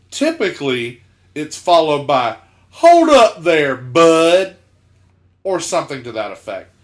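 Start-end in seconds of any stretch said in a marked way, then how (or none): 2.7-4.6 loud, slow, raised coach call; drawn-out mock holler from a coach; Southern coach. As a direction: imitate a tough coach stopping a kid. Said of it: "gruffest Southern athletic coach men".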